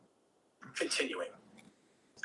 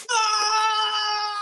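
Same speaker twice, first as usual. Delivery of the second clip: sharp high shout of impact, much louder; pained shout; 9 dB louder than usual. Acted. Zion slamming into Gabriel, a loud high shout. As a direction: cry out as you're knocked flying. Character slammed into, goes flying.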